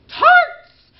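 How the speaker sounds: very high loud calling shout; queen calling out. Pretend. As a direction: bellow for the stolen tarts. Queen calls for the tarts.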